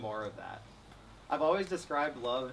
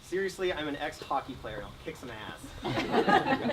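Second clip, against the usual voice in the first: laughing; 4 semitones higher, 7 dB louder than usual. Real, not acted. Jokey vow, laughing loudly.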